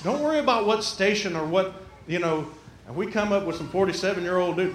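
usual speaking voice

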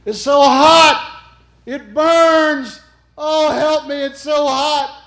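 high, loud, anguished wails; agonized screaming. Retold or imitated. Dying woman screams to the preacher in agony. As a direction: cry out in burning pain.

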